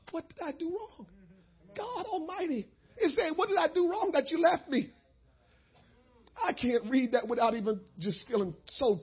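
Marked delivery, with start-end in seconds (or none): 0.1-4.9 soft, high, tearful plea; pleading. Acted. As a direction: plead softly like a hurt father. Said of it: "he's pleading with us".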